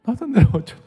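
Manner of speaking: loud, high cry of worry; alarmed outburst. Retold or imitated. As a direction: blurt the fear out loud. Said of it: "속으로".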